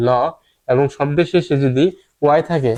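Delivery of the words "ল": ল as a clean "l"; bare l sound. Shown as a demonstration. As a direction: isolate the sound ল. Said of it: "এল এর জন্য উচ্চারণ কি". (শুধু ল ধ্বনি)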